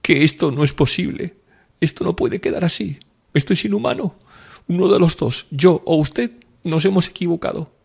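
very high, agitated protest; distressed, flashing-eyed woman; indignant. A narrator's reading. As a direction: insist fiercely that it cannot be. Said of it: "sus ojos negros centelleaban".

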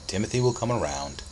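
ordinary delivery

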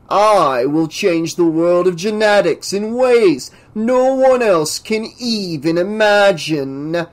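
slow, high, grandiose proclamation; megalomaniac scientist. Acted. Professor promises to remake genetics, megalomaniac. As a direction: swell with drawn-out self-importance.

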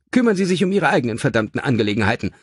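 fast, snapped retort; angry snap. A narrator's reading. Son snapping at the nosy cab driver, angrily. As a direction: snap it back furiously. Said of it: "schnauzte Michael wütend".

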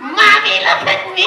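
high, loud thrilled call; excited puppet shout. Pretend. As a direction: shout up to mommy, delighted.

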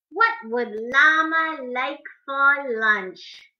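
ordinary delivery, nothing marked

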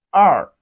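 "R" loud, dropping steeply in pitch; falling tone. Shown as a demonstration. Teacher models Chinese two for learners, loud falling "R". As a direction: hit "R" hard and let it fall. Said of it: "Falling tone".

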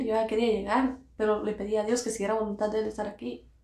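normal talking voice

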